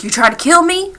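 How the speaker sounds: retold outrage, pitch leaping up; alarmed accusation. Shaken man confronting the storyteller after the bulb broke, alarmed accusation.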